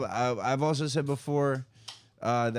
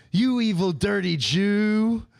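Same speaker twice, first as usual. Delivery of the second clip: mock angry troll; 6 semitones higher, 5 dB louder, slower than usual. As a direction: sneer it like an enraged troll. Troll's angry reply voiced.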